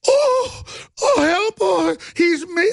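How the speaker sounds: high, shrill mock cry for help; hammed-up frightened wail; mock panic. Mocking the couple who panic at him, mock cries for help. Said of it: "And they went".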